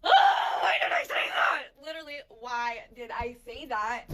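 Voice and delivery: hammed-up whispery fake crying; mock whining. Mocking the guy's regret with a mock sob.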